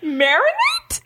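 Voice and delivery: high, drawn-out disbelieving question; can't believe the word is right; incredulous. Real, not acted.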